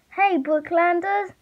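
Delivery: high, drawn-out call. A narrator's reading. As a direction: call slowly across a distance. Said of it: "she shouted".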